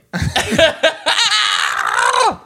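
squawking chicken laugh; a laugh that cracks into a high squawk; shrieking laugh. Real, not acted. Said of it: "my throat made a sound I'd never heard".

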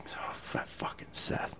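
whispered, sore groan of a curse. Retold or imitated. Sore after push-ups, cursing Seth in a whisper. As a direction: breathe the curse out under your breath, aching.

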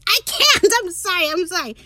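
high, loud burst of excitement; real gushing, apologetic outburst; overwhelmed fangirling. Real, not acted. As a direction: lose composure with giddy excitement, voice shooting up. Fan overwhelmed by favourite scene.

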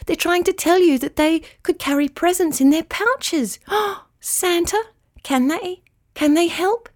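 put-on a little girl bursting with an idea; excited child. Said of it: "cried Immy".

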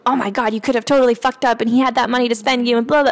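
breathless thrilled self-talk; excited inner voice. Retold or imitated. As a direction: rush it out giddy with relief. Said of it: "I was like".

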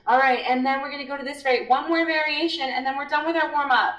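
high, upbeat instructor voice; chipper class instructor. Retold or imitated. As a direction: imitate a peppy trainer announcing the next move. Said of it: "and she's like".

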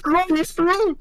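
very high, loud comic squawk; mock scare. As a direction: squeal it to spook a kid. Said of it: "someone walks up to them and go".

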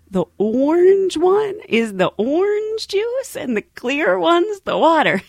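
high, slow, drawn-out obvious explanation; mock-patient explaining. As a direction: spell it out as if to a small child. Explaining the obvious to confused passenger.

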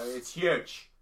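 loud, slow politician catchphrase; Trump. Retold or imitated. Co-host spoofing the viral one-word videos, loud mock impression. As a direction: punch the last word high and drawn out. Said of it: "like those Trump videos they do".

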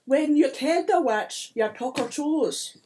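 put-on high-pitched, loud accented leprechaun voice; put-on lilting little-folk voice; accusing leprechaun.

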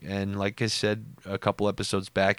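ordinary delivery

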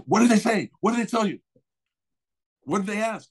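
high, urgent grilling; retold excited interrogation; eager questioning. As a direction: imitate a manager desperate for the news. Manager eagerly questioning him after audition.

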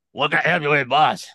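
a lightly voiced old man greeting. Old man at the tow lot greeting the stranger, lightly voiced.

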